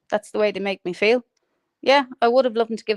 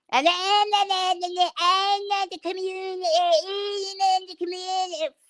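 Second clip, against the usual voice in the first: piercing mock voice; 9 semitones higher than usual. Ranter mimicking a rival's voice that would wake the dead, piercing mockery. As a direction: mimic her grating voice on one high held pitch. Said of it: "Your bleeding voice would go through anybody".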